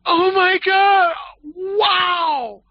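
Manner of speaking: shrieking high mock-shocked woman. Woman stunned by his bold line, high shrieking shock. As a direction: squeal it out, hammed-up disbelief. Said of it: "she just was like".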